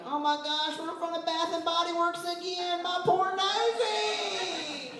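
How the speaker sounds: high, drawn-out mock whine; whiny smeller. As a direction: pout over a poor sore nose.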